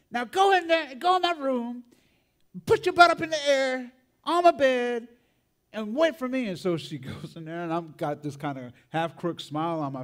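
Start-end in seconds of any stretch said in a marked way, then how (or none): 0.1-6.5 high, loud imitation of a furious grandma; angry scolding aunt. Aunt sends grandson to her room, angry orders. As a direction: send the child off to be punished. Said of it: "and she says".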